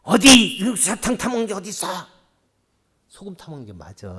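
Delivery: loud, high scornful dismissal; condemning retort. Shown as a demonstration. Husband condemning his bride's choice, loud and scornful. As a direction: shut her down and judge her. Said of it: "하면서 정죄하게 되면은".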